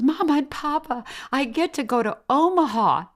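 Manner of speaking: high, thrilled announcement; bursting with news for her parents; excited daughter. Retold or imitated. Spanish doctor telling her parents about Omaha, high and thrilled. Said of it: "she goes".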